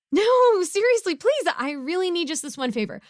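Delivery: high, loud desperate plea; beg, don't let them shut the door; pleading. Acted. Villain begging his neighbor for sugar, high and loud.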